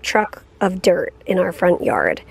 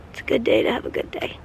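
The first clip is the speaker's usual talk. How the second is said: whispering; 6 dB quieter, faster than usual. Real, not acted. Whispered aside.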